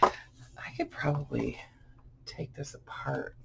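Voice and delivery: whispered aside to herself. Real, not acted. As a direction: breathe it under your breath. Muses to herself over book.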